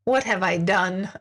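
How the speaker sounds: usual speaking voice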